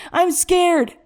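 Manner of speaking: high, desperate outcry; frightened scream. A narrator's reading. Terrified son pleads with his father, screaming. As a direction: scream out the fear. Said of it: "he screamed, sobbing".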